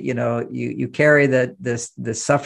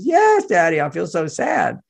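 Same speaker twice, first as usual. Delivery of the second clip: put-on high, whimpering little-girl voice, then slower; mock sad little girl; 4 semitones higher, slower than usual. Father imitates sad daughter answering him, high and whimpering.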